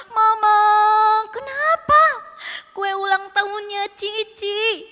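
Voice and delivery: high, loud, drawn-out whine; tearful shouting. Acted. Sister complaining to Mama about her cake, tearful wail. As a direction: wail your complaint like an upset child. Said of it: "sambil teriak-teriak dan menangis".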